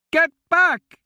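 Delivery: high, loud panicked scream. A narrator's reading. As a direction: scream a warning to get back. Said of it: "Arista screamed".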